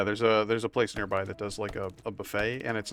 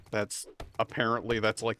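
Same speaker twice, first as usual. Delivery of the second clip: usual speaking voice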